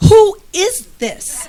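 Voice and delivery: amused disbelief. Retold or imitated. To her husband's flirty text, laughing high and slow. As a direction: giggle it out, stretching "Who is this?" in happy disbelief. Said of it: "I'm like".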